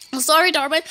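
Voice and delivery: ordinary talk, nothing marked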